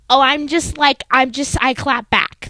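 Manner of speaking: high, loud mock of a sassy girl; hammed-up imitation of someone excusing rudeness; mocking sassy excuse. Mocking a sassy clap-back excuse.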